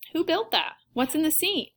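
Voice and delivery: high, lightly voiced eager kid; curious girl. A narrator's reading. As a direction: fire off questions as a curious girl. Curious girl quizzing her visitor.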